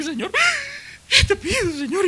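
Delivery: high, squeaky sobbing plea; mock tearful prayer. Ana praying in the temple, taken for drunk, mock tearful wailing. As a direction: wail it like a hammed-up weeper. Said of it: "la mujer está borracha".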